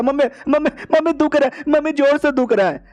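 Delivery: put-on a kid faking a stomach ache, overdoing it; faked pain wail. Son pretends to be sick to fool his parents, whining high and theatrically. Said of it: "बेटा acting करने लग जाता".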